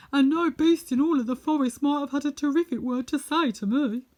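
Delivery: loud, proud lion telling of his forest. Acted. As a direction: play the Lion, booming out his news.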